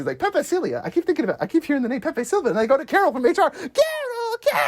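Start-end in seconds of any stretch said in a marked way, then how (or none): 0.2-3.5 high, fast mock-crazed rant; Charlie. Conspiracy theorist ranting about the mail, mock crazed. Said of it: "he's going crazy". 3.5-4.6 drawn-out high mock wail; Charlie. Crazed mailroom worker calling for Carol, high mock wail. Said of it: "he's going crazy".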